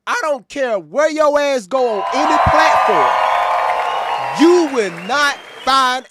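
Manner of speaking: hyped-up loud shout at the chat; fired-up shout. Real, not acted. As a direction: yell it to the whole stream. Hyping the stream's audience.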